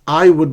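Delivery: "I would" said in full, loudly; both words whole in "I would"; uncontracted form. Shown as a demonstration. Teacher showing what gets shortened, loud full "I would".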